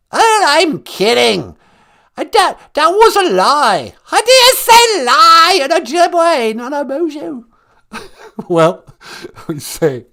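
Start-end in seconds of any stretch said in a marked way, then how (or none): 0.1-7.4 high, loud comic British royal grandmother; piercing put-on English matriarch voice; Queen Mum. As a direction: shriek it like a hammed-up old monarch. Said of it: "It's the Queen Mum".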